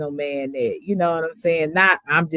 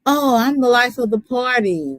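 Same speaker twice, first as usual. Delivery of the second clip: higher-pitched party-person front; breezy higher-voiced boast; put-on cheer; 6 semitones higher than usual. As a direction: play the life of the party. Voicing a front people put on.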